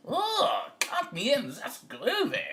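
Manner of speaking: high, excited cat voice; groovy cat. A narrator's reading. Cat joins the band, high and thrilled. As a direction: purr of delight pushed high. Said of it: "said the cat".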